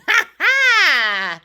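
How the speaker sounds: high, loud cackling laugh. Real, not acted. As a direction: burst out laughing.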